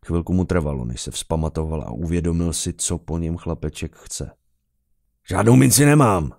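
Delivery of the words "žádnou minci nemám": loud, raised angry shout; overloud yell. A narrator's reading. Officer refusing the begging boy, angry yell. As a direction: yell it far too loudly. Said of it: "zařval možná až moc nahlas".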